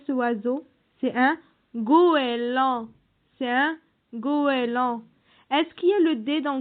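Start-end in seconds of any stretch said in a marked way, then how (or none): 1.7-2.9 "goéland" slow and loud, stretched go-é-land; syllable by syllable. Shown as a demonstration. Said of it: "combien de syllabes". 4.1-5.0 "goéland" slowly stretched into go-é-land; syllable by syllable. Shown as a demonstration. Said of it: "combien de syllabes".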